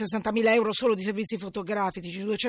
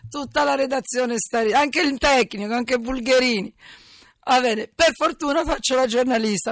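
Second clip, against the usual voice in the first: ridendo; 5 semitones higher, 7 dB louder than usual. Real, not acted. Ride della propria voce in onda.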